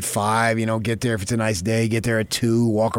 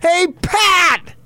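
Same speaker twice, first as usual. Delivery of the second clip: high, loud yell of a name; yelling across shop; 5 dB louder than usual. Retold or imitated. Mother yelling across the gift shop to her husband, high loud holler. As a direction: holler to get his attention across the room. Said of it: "She yelled out".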